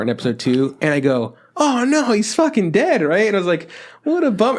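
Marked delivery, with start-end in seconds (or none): 1.6-4.6 high-pitched mock dismay; dismayed reaction. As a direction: re-enact a viewer's crushed reaction. Acting own reaction to a scene.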